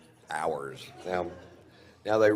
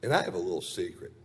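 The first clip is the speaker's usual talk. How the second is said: ordinary talk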